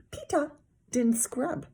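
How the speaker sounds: put-on high, slow little-kid voice; sing-song tattle; tattling toddler. A narrator's reading. Toddler telling on brother, high slow tattle.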